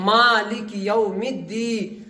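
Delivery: slow, syllable by syllable; measured, drawn-out teaching recitation; spelling-out recitation. Shown as a demonstration. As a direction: draw out each syllable for learners. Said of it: "آج میں ہجا کر رہا ہوں".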